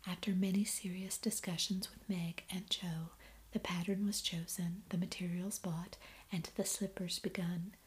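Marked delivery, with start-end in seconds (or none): none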